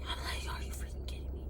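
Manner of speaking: exasperated whisper; hushed, unvoiced-style aside of disbelief. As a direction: whisper it under your breath, incredulous. Muttered reaction to being lit up.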